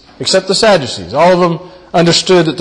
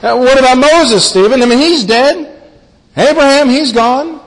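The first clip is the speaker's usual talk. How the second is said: higher, louder incredulous objection; a doubter's protest pitched high and louder; rising protest; 9 semitones higher, 3 dB louder than usual. Acted. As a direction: push back as if you can't believe it. Preacher voices listeners' objection.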